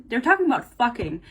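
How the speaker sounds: ordinary talk, nothing marked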